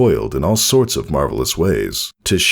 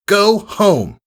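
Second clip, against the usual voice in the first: high, ringing order to stop; commanding mare's shout; 3 dB louder than usual. Acted. Sky blue mare halting the colt's fight, commanding shout. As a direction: break up the fight with authority.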